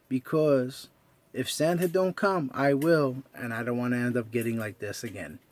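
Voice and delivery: ordinary delivery, nothing marked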